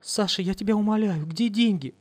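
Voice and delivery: high begging wail; high imploring cry; howling plea. Acted. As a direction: howl out the plea. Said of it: "взвыла Лиза".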